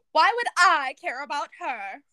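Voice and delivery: loud, indignant retort in fake British; hammed-up British indignation; [name], attempted British accent. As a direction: throw it back loudly, attempting British. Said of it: "your attempt at a British accent".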